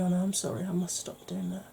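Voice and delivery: ordinary delivery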